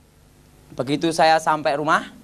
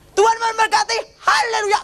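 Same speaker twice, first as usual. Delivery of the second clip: high ringing preacher blessing; imitating a pastor blessing the crowd, pitched high; mock blessing. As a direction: sing out the hallelujah like a preacher. [name] blessing again.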